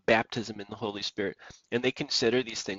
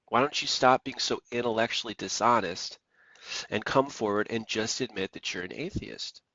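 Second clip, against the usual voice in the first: ordinary delivery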